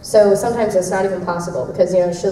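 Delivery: ordinary talk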